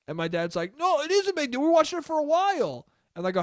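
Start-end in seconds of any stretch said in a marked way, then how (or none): 0.8-2.9 high-pitched, worked-up retort; mock indignant dad. Dad contradicting son about the movie, mock indignant. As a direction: imitate dad protesting in a raised pitch. Dad arguing in retold quarrel.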